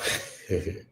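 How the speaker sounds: soft, brief laugh; short chuckle. Real, not acted. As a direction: break off into a small chuckle. Breaks off into a chuckle.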